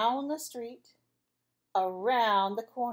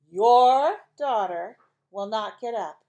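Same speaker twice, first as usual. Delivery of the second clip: much louder, slow, fed-up declaration; exasperated father; 11 dB louder, slower than usual. Acted. Father reporting to mother, loud and exasperated.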